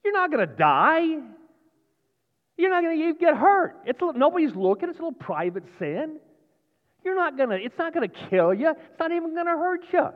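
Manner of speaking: tempter. Acted. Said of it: "God's next, next lie is".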